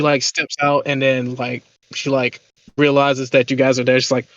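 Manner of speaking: normal talking voice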